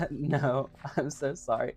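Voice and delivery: usual speaking voice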